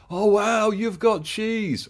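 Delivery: high, awed dog voice, drawn out slowly; dog's voice. Pretend. Dogs staring at cheese in friend's hair, high awed slow voice.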